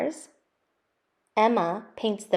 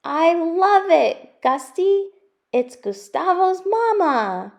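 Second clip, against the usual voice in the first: much higher and louder mother's voice, slowing at the end; a loving mother revealing her surprise; joyful mother's voice for her son; surprised mother; 10 semitones higher, 7 dB louder, slower than usual. Acted.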